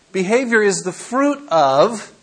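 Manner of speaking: ordinary delivery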